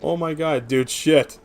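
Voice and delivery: normal talking voice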